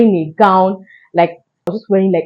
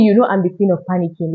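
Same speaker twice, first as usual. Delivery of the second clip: ordinary delivery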